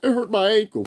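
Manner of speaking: high, fast, whiny mock; high hammed-up whining; mocking whiny man. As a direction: sound like a complaining kid. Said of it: "his whole diatribe is".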